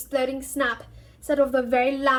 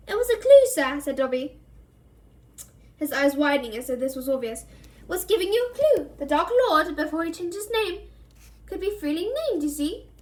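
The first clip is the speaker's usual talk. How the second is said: high elf voice, earnest; Dobby patiently explaining the clue; 4 semitones higher than usual. Acted.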